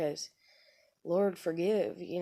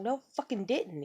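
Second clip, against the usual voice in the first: higher-pitched replayed comeback; retold indignant denial; 5 semitones higher than usual. Woman retells contradicting her husband back, indignant. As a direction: act out digging in, not shouted. Retold bickering, denying again.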